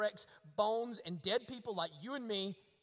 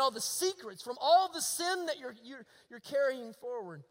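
louder, emphatic sermon delivery; impassioned preaching; 4 semitones higher, 9 dB louder than usual. Real, not acted. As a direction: preach it out, leaning hard on key words. Preacher swells in exhortation.

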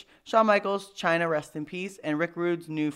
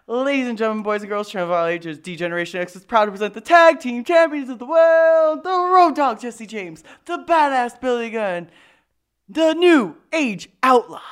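loud booming ring announcer intro; 5 semitones higher, 8 dB louder than usual. Pretend. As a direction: announce the champions like a wrestling ringmaster. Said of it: "That's how old school I am".

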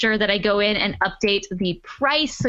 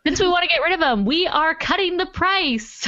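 excited; 8 semitones higher than usual. Real, not acted. Excited t-shirt price cut.

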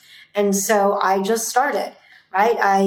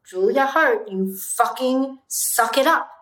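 stern self-talk; 3 semitones higher than usual. Retold or imitated. Retold stern pep talk to herself.

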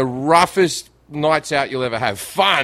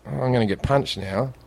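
lower, softer inner voice; quiet dread; 5 semitones lower, 6 dB quieter than usual. Retold or imitated. Brain to himself after the punch, quiet mock dread. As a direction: voice a sinking thought under the breath. Acts out his brain's sudden worry.

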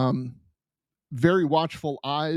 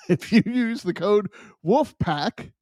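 laughing through the correction; 6 semitones higher, slower than usual. Real, not acted. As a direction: fix the code while chuckling. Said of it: "Oh, I'm sorry".

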